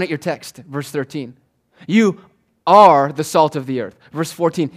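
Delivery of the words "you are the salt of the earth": loud, punched "You" and "are"; emphatic affirmation. Shown as a demonstration. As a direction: hit "You" and "are" hard, with a pause between. Preacher stresses the verb of verse.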